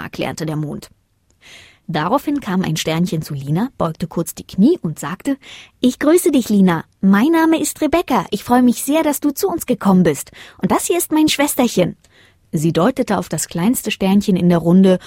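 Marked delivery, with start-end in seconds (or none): 5.8-12.0 high, cheery small voice; friendly little star. A narrator's reading. Star Rebecca welcomes Lina, friendly little voice. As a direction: greet in a pitched-up, eager voice.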